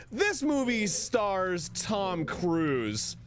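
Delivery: high, drawn-out mock outrage; angrily spouting facts. Demonstrating an angry fact-only review site, hammed-up outrage. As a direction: declaim the bare fact slowly and indignantly. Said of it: "me angrily spouting facts".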